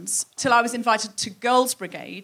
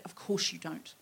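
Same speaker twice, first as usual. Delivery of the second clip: very soft, low remark; husband's aside; 5 semitones lower, 13 dB quieter than usual. Acted. Husband answering his wife in the pub, quiet aside. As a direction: drop right down to a dry undertone. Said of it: "her husband looked at her and said".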